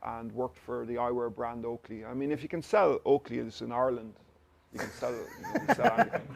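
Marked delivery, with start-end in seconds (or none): 4.7-6.4 laughing through the punchline; amused laugh on the tag line. Real, not acted. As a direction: chuckle it out, pitch jumping up. Joking punchline about selling Oakley.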